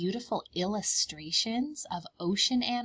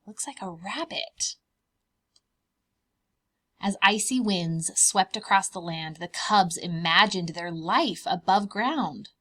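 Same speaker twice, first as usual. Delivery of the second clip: ordinary delivery, nothing marked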